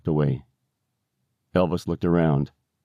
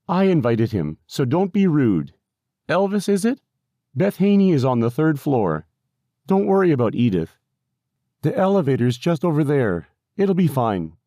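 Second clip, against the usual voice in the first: higher-pitched caregiver character; lightly voiced helpful worker, pitched up; brisk, reassuring caregiver; 8 semitones higher than usual. A narrator's reading. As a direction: chide lightly, then direct the visitor.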